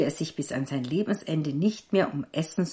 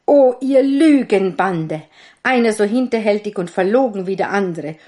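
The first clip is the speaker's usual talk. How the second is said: louder, higher furious outburst; raging tailor; 4 semitones higher, 8 dB louder than usual. A narrator's reading. Tailor raging at his lying sons, loud and furious. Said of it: "wütete der Schneider".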